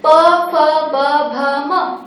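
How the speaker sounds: प फ ब भ म, each said with both lips pressed together and released; lips closing on each letter. Shown as a demonstration. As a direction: press both lips shut for प फ ब भ म, one at a time. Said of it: "मेरे दोनों होंठ जुड़ रहे हैं".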